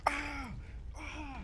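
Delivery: dad faking pain with high yelps; mock pain yelps. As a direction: pretend it hurts with high little cries. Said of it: "he pretended".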